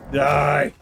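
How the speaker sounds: shouted battle cry; angry shout. Pretend. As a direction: yell it at the enemy. Attacking enemies in game.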